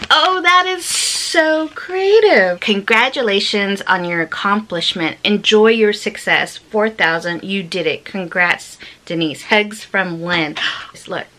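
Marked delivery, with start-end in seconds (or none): 0.1-2.6 amazed. Real, not acted. Amazed by a handmade card. 10.7-11.3 awed whisper. Real, not acted. Gasping over a card.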